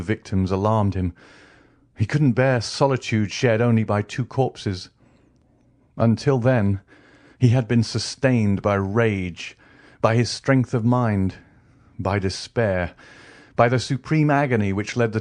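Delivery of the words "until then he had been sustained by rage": ordinary delivery, nothing marked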